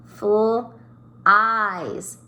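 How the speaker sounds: "Full eyes" as the two parts of "flies", with a gap; sounded out. Shown as a demonstration. Teacher sounding out "flies" for pupils, "Full" then "eyes" apart. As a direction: stretch FL, pause, then EYES. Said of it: "If I were to say that last word".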